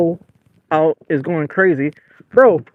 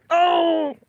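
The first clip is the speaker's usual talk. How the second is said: surprised; 11 semitones higher, 4 dB quieter than usual. Real, not acted. Big fish surprise.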